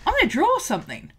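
mock-eager artist announcing a plan; eager resolve. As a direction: bounce into the task with gusto. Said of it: "us sitting down and going".

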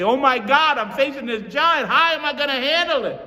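high, loud mock panic. Mimicking a fearful believer, high and loud. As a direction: overplay the worried believer. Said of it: "we have tended to say".

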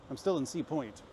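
normal talking voice

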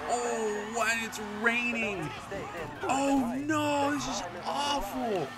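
high, slow, drawn-out whine; mock whine. Real, not acted. Gamer complains to viewers about the rain, high slow whine. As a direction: whine it high like a put-upon diva.

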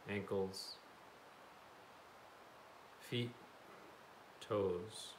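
calm, even meditation guide with long pauses; settled, unhurried instructions in short phrases; guided meditation. Real, not acted. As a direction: lead a body scan gently, leaving space.

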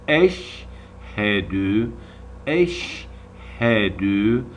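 eşhedü drawn out very slowly, twice; slow recitation. Shown as a demonstration. Teacher reciting with the class, very slow. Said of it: "birkaç kere okuyalım şimdi".

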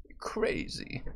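breathy whisper. Real, not acted. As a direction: whisper it close. Whispered aside.

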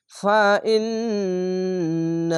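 ফা ইন্নামা slowly with nasal hum on ন্না and long মা; slow chant of ফা ইন্নামা; ghunna and stretch. Shown as a demonstration. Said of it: "ওয়াজিব গুন্না".

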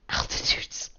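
stretched, voiceless echo; slow whisper. Pretend. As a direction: whisper it slowly, drawing it out. Echoes word in strained whisper.

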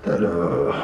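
very low, muffled pilot drone; mumbled pilot voice. Retold or imitated. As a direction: drop into a deep muffled mumble. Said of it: "looked at you and went".

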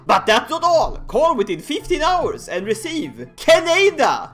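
infomercial announcer. Pretend. Host jokingly pitches Canada like a shopping-channel announcer. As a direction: sell Canada like a late-night TV offer.

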